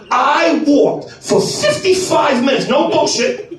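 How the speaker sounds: usual speaking voice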